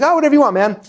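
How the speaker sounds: high, airy, careless answer; breezy shrug. Retold or imitated. Disorganized friend brushing off the question, a high breezy shrug. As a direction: toss it off like it doesn't matter.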